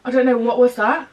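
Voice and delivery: usual speaking voice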